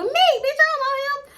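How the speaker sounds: very high, loud squawk; exaggerated high-pitched protest; mock indignant retort. Imagined retort to jealous friend.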